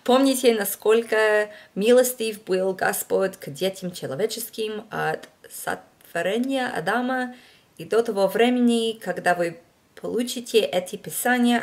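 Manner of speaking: out-of-practice learner reading scripture in Russian; rusty Russian reading. Shown as a demonstration. Reading a Russian passage aloud for viewers in her rusty Russian. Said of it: "this just isn't how it's supposed to sound".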